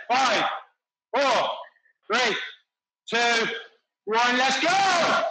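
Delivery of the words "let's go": shouted cue. Real, not acted. Coach calls the start.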